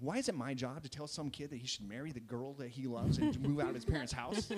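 hammed-up exasperated protest; exasperated complaint. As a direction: act out a coach loudly fed up. Acts out the coach's exasperated complaint.

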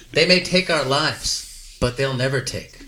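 slow, measured recital of a battle speech; solemn film quote. Acted. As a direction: draw it out with pauses, falling away at the end. Recites the famous Braveheart speech.

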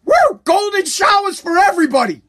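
high, giddy cheer with a whoop; hammed-up giddy voter; mock celebrating. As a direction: play the gullest fan of free money. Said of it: "You're like".

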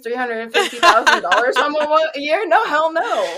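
laughing, hammed-up "hell no"; mock boyfriend. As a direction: play the boyfriend refusing, laughing. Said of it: "He's like".

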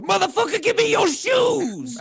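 high shouted mugger voice. Retold or imitated. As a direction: yell it like a street mugger. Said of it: "look at you and go".

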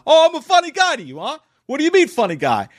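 high, loud mock gangster challenge; Goodfellas funny guy. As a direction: spit the famous funny guy line in a put-on voice. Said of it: "sounds exactly like the".

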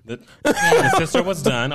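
laughing through the words; slow, amused laugh-talk. Real, not acted. Repeating friend's joke, laughing.

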